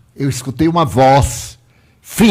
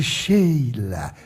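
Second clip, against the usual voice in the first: ruined voice; 5 semitones lower, 7 dB quieter than usual. Retold or imitated. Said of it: "mas aquele horror de voz".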